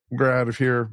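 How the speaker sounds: ordinary delivery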